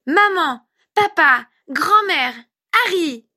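put-on very high, loud little-kid word list; thrilled small boy calling out words; delighted child. A narrator's reading. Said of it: "débita-t-il d'un ton ravi".

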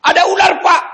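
high, loud panic yell; panicked yell. Acted. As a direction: scream for help at a snake. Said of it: "masih bisa teriak".

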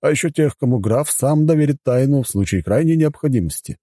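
high-pitched formal butler explaining house rules; courteous butler. Acted. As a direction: present the hotel as its dutiful butler. Voices the butler. (учтивый дворецкий)

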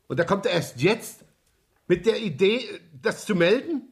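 outraged that it came so late; indignant disbelief. Acted. Inspector on the phone, indignant at late report.

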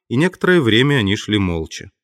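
ordinary delivery

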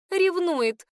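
high, loud snort of a word; snorting remark. A narrator's reading. Girl snorting that Emma is jealous, high and loud. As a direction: toss it off with a knowing snort. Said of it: "фыркнула одна из девушек".

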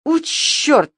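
high, exploding curse; sudden exasperated cry; sudden angry outburst. A narrator's reading. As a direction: burst out with uncontrolled anger. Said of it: "неожиданно крикнул он".

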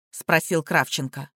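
ordinary delivery, nothing marked

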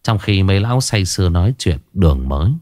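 usual speaking voice

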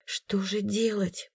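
hushed, slow worried whisper. A narrator's reading. Heroine whispering over the sick child, hushed. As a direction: breathe it out softly. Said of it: "прошептала я".

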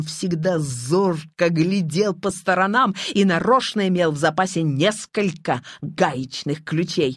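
usual speaking voice

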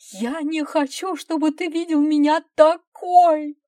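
high, loud, desperate outburst; anguished cry. A narrator's reading. Wife hides her changed looks from husband, anguished cry. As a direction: wail it out in anguish. Wife cries out, hiding herself.

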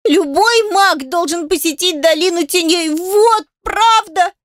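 high, slow tearful cry; sobbing. Acted. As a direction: burst into sobs on it. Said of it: "зарыдала Адель".

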